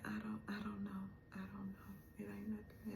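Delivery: whispered, hopeless and fading. Retold or imitated. Couple facing bad news, whispered. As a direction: breathe it out in defeat. Retold despairing words, whispered.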